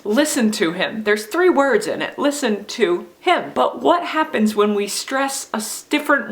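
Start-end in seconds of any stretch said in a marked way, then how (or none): none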